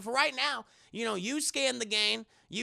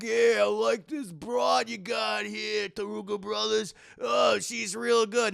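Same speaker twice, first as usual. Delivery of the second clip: leering gangster; 4 dB louder than usual. Retold or imitated. Gangster comments while watching.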